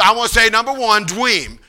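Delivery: childlike imitation, dream said as "dweem"; Paul preaching. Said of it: "And he said".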